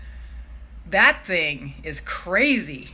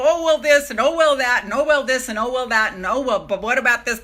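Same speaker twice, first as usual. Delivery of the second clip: higher and louder mock worrying voice; mock fretting; 3 semitones higher, 5 dB louder than usual. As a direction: play the nagging doubts, hammed-up. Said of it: "You might go".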